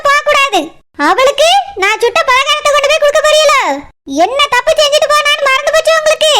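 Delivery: furious, rapid-fire challenge; angry outburst. Acted. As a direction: fire back at your mother-in-law in disbelief. Daughter-in-law flares up at mother-in-law.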